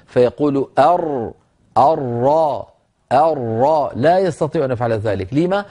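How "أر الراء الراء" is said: "أر، الراء، الراء" with ر rolled on the tongue tip. Shown as a demonstration. Teacher showing what some students cannot do, "أر، الراء، الراء" with a tongue-tip ra. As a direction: tap the tip of the tongue on the palate for each ر in "أر، الراء، الراء". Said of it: "يقرع بطرف لسانه مخرج الراء".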